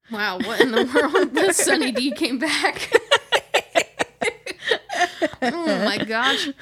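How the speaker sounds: laughing in disbelief. Real, not acted. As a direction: break into surprised laughter. Startled by a sudden noise.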